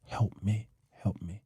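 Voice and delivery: very soft, subdued plea; quiet imitation of pleading for help; retold boo-hoo pleading. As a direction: retell tearful begging under your breath. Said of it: "Just boo-hooing".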